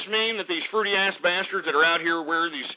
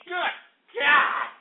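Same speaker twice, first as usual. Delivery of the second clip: exasperated shout; 11 semitones higher than usual. Acted. Sudden exasperated outburst.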